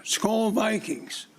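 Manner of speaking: a playful stadium rallying cry; mock fan cheer. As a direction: stretch it out slowly like a game-day chant. Proud Minnesota fan cheer.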